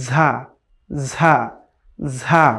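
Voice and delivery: ঝা with air through the teeth; Arabic ز demo; airy za. Shown as a demonstration. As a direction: breathe out on ঝা. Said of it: "একটু বাতাস বের হবে".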